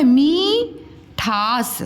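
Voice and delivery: मीठास with mee held long; गलत लंबी ई. Shown as a demonstration. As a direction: stretch मी, then ठास. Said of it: "मीठास हम बोलते हैं कि मिठास". (मीठास में मी खींचकर)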